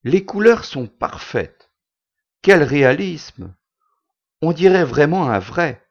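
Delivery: high, loud, drawn-out delight; marvelling admiration. Acted. Customer praising the realism of his order, marvelling. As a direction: gush slowly over every detail.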